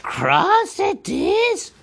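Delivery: high, slow hissing creature voice; Gollum hissing. A narrator's reading. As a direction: hiss it slyly at the hobbit. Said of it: "hissed Gollum".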